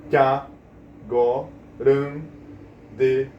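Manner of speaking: five beats with long pauses between; isolated syllables. Shown as a demonstration. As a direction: say one syllable, wait, then the next. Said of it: "tem apenas cinco".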